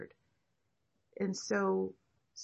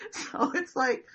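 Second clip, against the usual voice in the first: ordinary delivery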